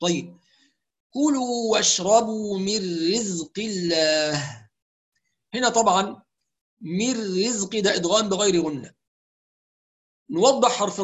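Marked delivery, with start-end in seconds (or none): 1.1-4.7 slow measured tajwid reading; Quranic recitation. Shown as a demonstration. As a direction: recite the verse slowly. Recites verse.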